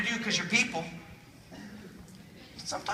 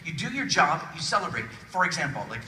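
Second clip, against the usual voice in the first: ordinary talk, nothing marked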